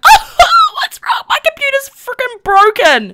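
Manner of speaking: high, loud hammed-up wail; mock wailing. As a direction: wail in pretend despair over a broken computer. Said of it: "I'm gonna be like".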